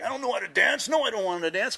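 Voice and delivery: high, panicky protest; shy nerdy boy. Retold or imitated. Shy boy refuses a girl's dance, flustered. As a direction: imitate a reluctant boy squirming out of it. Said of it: "I was the shy".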